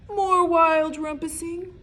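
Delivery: plain reading voice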